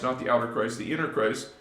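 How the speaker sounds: ordinary delivery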